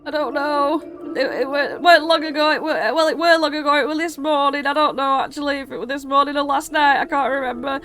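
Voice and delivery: high-pitched dithering distress; panicked father. Acted. Father asked when the kids left, flustered despairing panic. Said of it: "absolutely shitting himself".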